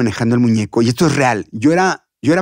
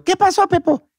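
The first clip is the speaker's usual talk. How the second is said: put-on very high-pitched child-character voice; Chabelo. Kids' show host greeting the puppet, high childlike voice. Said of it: "me dice Chabelo".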